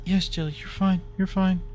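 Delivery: high, gentle pet talk; reassuring the dog. Real, not acted. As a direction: tell the dog it's fine.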